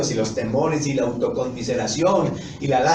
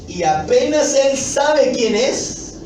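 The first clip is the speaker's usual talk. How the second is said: predicación enfática; 10 semitones higher, 5 dB louder, slower than usual. Real, not acted. Predicador enfatiza con fuerza.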